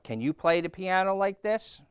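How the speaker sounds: high-pitched boastful question; showing off. A narrator's reading. Toad at the piano to Frog, showing off.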